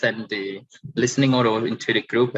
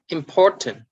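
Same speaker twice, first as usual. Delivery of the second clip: "important" with POR raised and strong; stress on middle syllable; 4 semitones higher, 4 dB louder than usual. Shown as a demonstration. Teacher reading out the middle column, middle stress on "important". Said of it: "stress on the middle".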